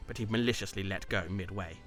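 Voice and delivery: usual speaking voice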